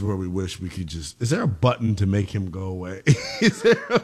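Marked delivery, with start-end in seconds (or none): 3.0-4.1 breaks into high laughter mid-question; real laughter cutting off the words; laughing. Real, not acted. Laughing at co-host's jingle remark.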